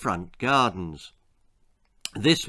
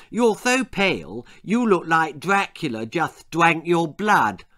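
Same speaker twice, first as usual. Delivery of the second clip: put-on high-pitched lisping child; honest little sister; 4 semitones higher than usual. Little sister telling Ginger how he looks, lisped. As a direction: blurt it out as a frank small girl. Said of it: "said Eliza honestly".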